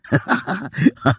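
short falling laugh; boyish laugh. Acted. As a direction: laugh at the girl's cheek. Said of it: "Paragon roześmiał się".

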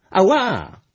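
loud, high yelp of pain; pained "ow!" cry. A narrator's reading. Gluś cries out as Brąba kicks him, pained yelp. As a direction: yelp as if just kicked. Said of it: "zawołał Gluś".

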